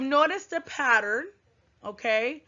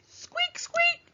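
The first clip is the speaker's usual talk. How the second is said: tiny squeaks, much higher; mouse squeak. Shown as a demonstration. Teacher imitates a mouse squeaking for her class, very high. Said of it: "little mice squeak".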